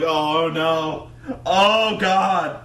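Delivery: loud, drawn-out moan of doom; mock dread. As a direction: imitate a horrified commentator. Acted commentator dread.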